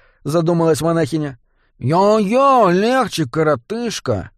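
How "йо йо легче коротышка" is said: high, slow pained goblin plea; moaning goblin. A narrator's reading. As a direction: groan it out under an arm lock. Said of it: "простонал гоблин".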